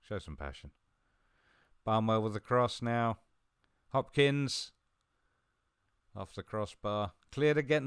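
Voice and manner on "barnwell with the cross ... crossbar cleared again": high, loud match commentary; live play-by-play. Real, not acted. Gamer calls a shot off the crossbar, urgent commentary. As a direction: call each touch urgently as it happens.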